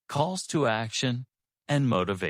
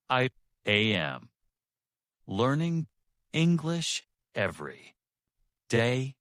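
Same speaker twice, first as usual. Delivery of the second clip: word by word, long pauses, raised middle words; slow and heavy; 2 semitones higher than usual. Shown as a demonstration. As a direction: give each word weight and let silence sit between. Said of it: "slow and heavy".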